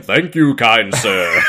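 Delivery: slow, put-on British accent; hammed-up British courtesy; mock British accent. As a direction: draw it out in a silly British accent. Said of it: "speaks in a perfect, eloquent British accent".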